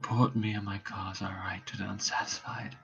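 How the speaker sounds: faint dying voice. Acted. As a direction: let the life drain from the voice. Hamlet dying, fading voice.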